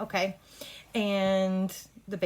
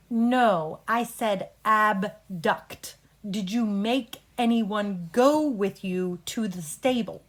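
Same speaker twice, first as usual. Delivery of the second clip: real slow; 4 dB louder, much slower than usual. Shown as a demonstration. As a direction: break it down slowly for a slow witness. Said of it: "So real slow".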